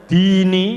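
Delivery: "dini" high and loud, first i held long; di panjang. Shown as a demonstration. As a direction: lengthen the DI of "dini". ("dini" dengan di dipanjangkan)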